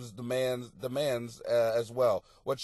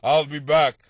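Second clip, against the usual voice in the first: Schwarzenegger voice; 7 dB louder than usual. Retold or imitated. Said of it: "look at Schwarzenegger".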